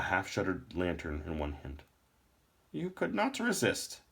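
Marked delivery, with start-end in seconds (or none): 2.7-4.0 put-on high, slow old man's voice; a stern old lore master.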